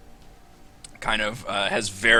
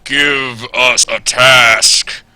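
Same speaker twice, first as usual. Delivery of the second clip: slow, much louder robotic boom; 4 semitones higher, 14 dB louder than usual. Acted. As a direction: boom it out like a steel guardian. GM voices the robotic champion.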